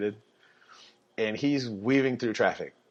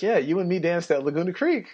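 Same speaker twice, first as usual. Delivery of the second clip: higher, teasing cop voice; friendly cop; 8 semitones higher than usual. Retold or imitated. Officer reminds the driver they've met, higher and teasing. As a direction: tease him, you know him.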